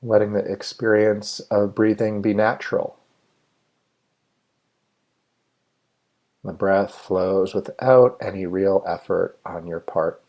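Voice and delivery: measured meditation guide with long, spacious pauses; guided meditation. Real, not acted. Meditation leader inviting listeners to settle and let go, guided meditation. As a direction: lead listeners unhurriedly, phrase by phrase, leaving room to breathe.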